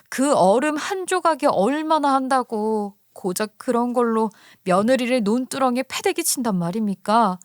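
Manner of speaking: ordinary delivery, nothing marked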